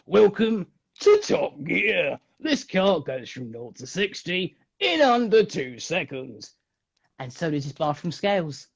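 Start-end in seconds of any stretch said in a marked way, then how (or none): 0.0-6.5 lightly voiced slow Clarkson presenter drawl; a hint of Clarkson, slow and deliberate; Jeremy Clarkson impression. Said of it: "the car that Jerry Clarkson made".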